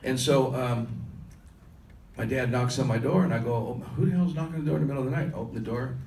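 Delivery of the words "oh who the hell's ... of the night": fast, higher annoyed grumble at being woken; groggy annoyed. Retold or imitated. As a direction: rattle it off, irritated at the knocking.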